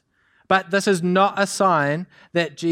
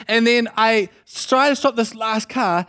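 usual speaking voice

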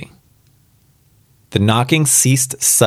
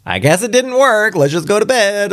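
high-pitched put-on character voice; voiced old couple; 13 semitones higher than usual. Reader voicing the couple giving up on the wish, high put-on voice.

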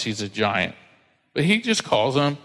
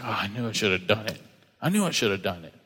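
much softer and lower, mock regret; mock regretful sigh; 5 semitones lower, 6 dB quieter, faster than usual. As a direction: mutter it like you blew your chance. Regret after plans fall apart.

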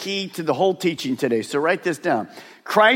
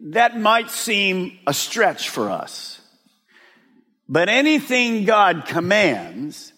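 usual speaking voice

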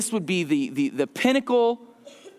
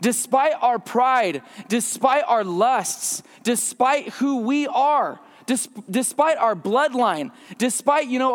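usual speaking voice

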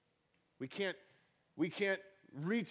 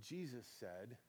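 ordinary talk, nothing marked

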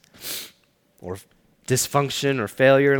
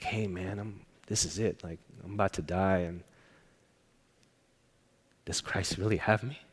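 much softer and lower, hushed fear; fearful dying man; 3 semitones lower, 9 dB quieter than usual. Retold or imitated. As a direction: let the fear sit quietly in it. Dying uncle afraid on phone.

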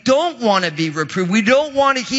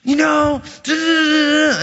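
higher-pitched worked-up sputter; mock frustration; 7 semitones higher than usual. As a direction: act out getting all mad, syllables tumbling out. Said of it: "It's getting me all mad. I'm all just".

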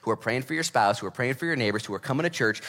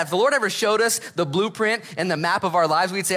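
ordinary delivery

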